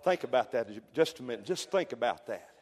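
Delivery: usual speaking voice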